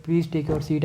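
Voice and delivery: normal talking voice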